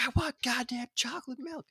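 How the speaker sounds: put-on high, strained child voice; whiny kid demand. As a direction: demand it like a furious little kid. Kid freaking out.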